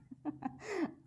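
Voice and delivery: short high amused laugh; light laugh. Real, not acted. Laughs after hotel complaint.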